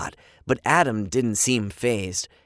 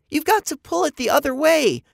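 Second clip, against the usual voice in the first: frantic; 5 dB louder than usual. A narrator's reading. Frantic kids.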